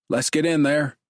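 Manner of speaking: rally the other officer in; order. Acted. Officer ordering his partner into the house, commanding. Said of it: "Stephen orders".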